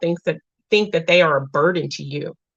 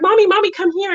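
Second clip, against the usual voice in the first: high-pitched imitation of pleading little kids; children calling for mom; 13 semitones higher, faster than usual. Kids calling mom away from housework, high imitated child voices. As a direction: mimic kids calling out, much higher voice. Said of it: "my babies will be like".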